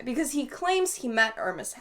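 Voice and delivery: usual speaking voice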